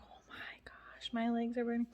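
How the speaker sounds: breathy whisper of pain; whispered oh my gosh, legs burning; whispered complaint. Retold or imitated. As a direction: hush it out like it hurts. Retelling sore legs, whispered.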